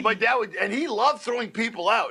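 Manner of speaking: ordinary talk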